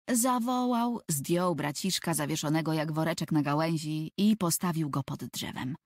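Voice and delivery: ordinary delivery, nothing marked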